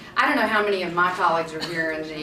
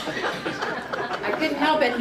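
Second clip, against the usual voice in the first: higher, slow, through laughter; laughing apology; 7 semitones higher, much slower than usual. Real, not acted. Panel host excusing her own joke to the audience, laughing. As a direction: apologize while giggling helplessly.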